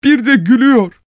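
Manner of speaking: high, weeping outburst; sobbing wife. Acted. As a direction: cry it through tears. (hıçkırarak yüksek sesle)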